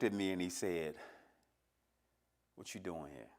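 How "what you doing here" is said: cold father. Retold or imitated. Retold cold reply of his father.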